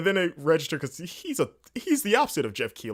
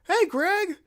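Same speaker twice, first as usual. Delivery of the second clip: much higher, louder dorky hello; 6 dB louder than usual. Retold or imitated. Stopping to greet Greg in a dorky high voice. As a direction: greet him like an awkward dork. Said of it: "an equally dorkier voice".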